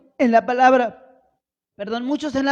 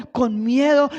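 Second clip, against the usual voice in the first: ordinary talk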